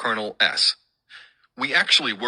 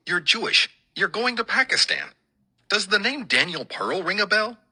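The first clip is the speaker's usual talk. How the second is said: much higher voice, imitating a worried son's challenge; retold protest, pitched high throughout; disbelieving son; 12 semitones higher than usual. Son challenging his father's trip to Pakistan, high-pitched imitated disbelief.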